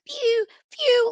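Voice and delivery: high squeaky "pew, pew" sound effect; mock whistle noise. Mocking a player going down.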